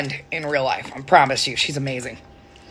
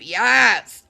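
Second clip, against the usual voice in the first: ordinary talk, nothing marked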